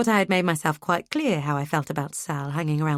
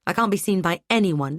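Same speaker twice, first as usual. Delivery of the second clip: ordinary delivery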